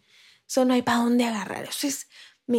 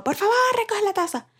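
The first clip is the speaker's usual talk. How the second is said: retold yell; 10 semitones higher than usual. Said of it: "le he gritado".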